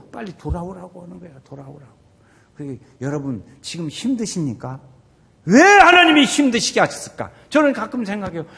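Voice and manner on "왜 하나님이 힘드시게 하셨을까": loud, high rhetorical cry; impassioned questioning. Acted. Preacher voices a struggling believer questioning God, impassioned loud cry.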